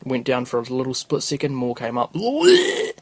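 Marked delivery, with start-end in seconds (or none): 2.1-2.9 high, loud imitated puke sound; mock retching noise. Podcaster acts out vomit coming up, mock retching noise. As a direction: make an exaggerated spewing noise, pitched up. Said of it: "didn't make that noise either".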